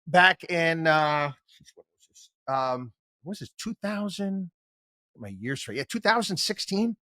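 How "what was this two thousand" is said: ordinary talk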